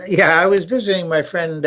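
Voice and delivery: ordinary talk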